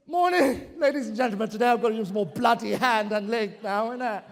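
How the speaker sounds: mock Cockney lad, innit; mock Cockney speaker. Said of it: "Next time they come up on stage".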